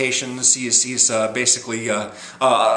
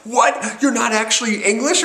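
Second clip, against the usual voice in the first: high, startled double-take, higher and louder; mock-stunned cry of disbelief; shocked disbelief; 9 semitones higher, 3 dB louder than usual. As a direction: gasp it out like you just got fooled. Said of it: "and she's like".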